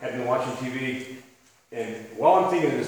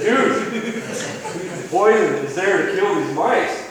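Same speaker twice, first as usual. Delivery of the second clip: laughing disbelief, much higher and louder, slowing down; exasperated dad; 6 semitones higher, 6 dB louder, much slower than usual. Retold or imitated. Dad to six-year-old who wants to touch poison: exasperated, laughing. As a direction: spell it out to a stubborn kid.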